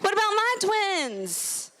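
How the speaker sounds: put-on high, eager, pleading teen voice; squeaky eager camp girl calling for attention; self as eager teen. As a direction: push your chest out vocally, hey hey look at me. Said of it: "And I'd be like".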